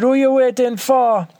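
high, loud Jamaican street voice; Jamaican accent. Retold or imitated. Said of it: "this fucking crazy Jamaican West Indian accent".

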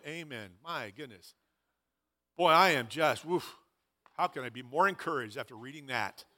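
deadpan sarcasm. Pretend. Sarcastic reaction to depressing reading.

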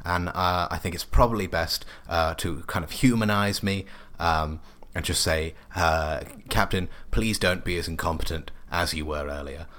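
lightly voiced science officer; a hint of a fussy officer voice; Catherine. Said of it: "First Science Officer Catherine here".

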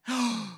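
sharp, hammed-up gasp; mock shocked gasp. Husband hears of house given away, mock shocked gasp. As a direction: suck in air as the scandalized husband.

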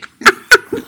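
shrieking high laugh; high squeal of laughter. Real, not acted. Laughing at own French.

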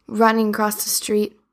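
plain reading voice

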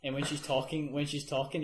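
usual speaking voice

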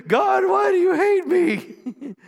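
high, drawn-out wail at heaven; hammed-up plea shouted up to God; mock anguished cry. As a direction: cry out loudly in exaggerated despair. Acted desperate complaint to God.

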